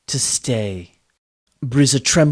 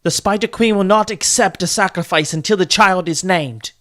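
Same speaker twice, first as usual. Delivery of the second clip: higher, pressing plea; urgent insistence; 7 semitones higher, 5 dB louder than usual. A narrator's reading. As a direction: push her to act now. Daughter urges mother at sacrifice.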